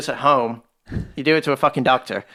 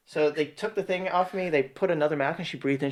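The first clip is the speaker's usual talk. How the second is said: ordinary talk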